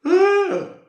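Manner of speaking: big, high, imitated yawning sigh; loud mock yawn-sigh. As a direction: ham up the man's noisy yawn. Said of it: "the bloke here kept going".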